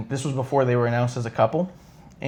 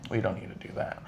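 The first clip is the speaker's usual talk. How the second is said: low, hushed, low-key refusal; Randy Orton; 4 semitones lower, 13 dB quieter, faster than usual. Retold or imitated. Fan imitating a wrestler declining a photo gimmick, quiet and easygoing. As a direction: turn it down gently, much softer and lower. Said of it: "he said it like this too".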